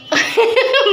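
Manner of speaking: short, high-pitched chuckle; amused laugh. Real, not acted. Mother amused by her child's homesick answer, short chuckle. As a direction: burst into a brief amused laugh.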